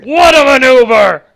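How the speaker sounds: loud, slow, stretched announcer bellow; Vince McMahon impression. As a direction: bellow it like the wrestling boss. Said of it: "Fucking Vince".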